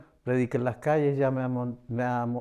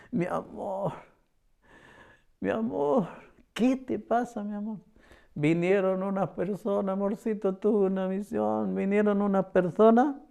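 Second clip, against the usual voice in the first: retold tearful voice; 6 semitones higher than usual. Wife tells husband of her vision, imitated tearful distress. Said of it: "Llorando, dice".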